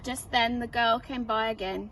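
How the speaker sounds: ordinary delivery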